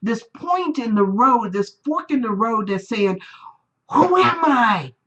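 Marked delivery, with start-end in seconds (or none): 3.9-4.9 slow, louder searching "Who am I?"; dramatic question. Real, not acted. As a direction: pose it like a big question.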